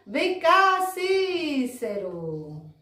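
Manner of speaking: high drawn-out playful call. Pretend. Teacher summons Cícero to greet the class, calling out. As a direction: call him over in a sing-song, stretching the name. Calls the character Cícero over.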